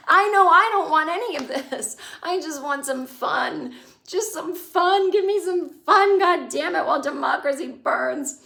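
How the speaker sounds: high, drawn-out, mock-desperate begging; loud, hammed-up frustration; exasperated plea. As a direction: plead for it, stretching key words. Acting out exasperated plea for fun.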